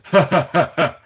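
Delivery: mock laugh in separate bursts; mimicked gloating laugh. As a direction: imitate the desk guy's laugh. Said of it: "the guy at the desk goes".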